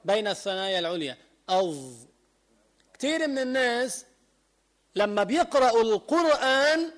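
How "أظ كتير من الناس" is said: usual speaking voice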